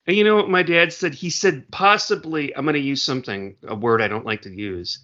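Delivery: normal talking voice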